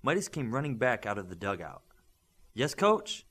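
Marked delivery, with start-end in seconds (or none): 2.5-3.2 put-on eager boy. A narrator's reading.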